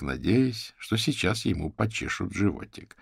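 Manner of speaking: usual speaking voice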